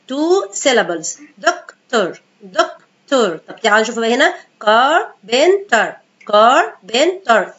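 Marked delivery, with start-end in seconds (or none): 4.6-7.5 carpenter spoken slowly, each syllable sounded out. Shown as a demonstration. Teacher slowly sounding out carpenter in syllables. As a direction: break carpenter into its three syllables for the class. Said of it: "Three syllables".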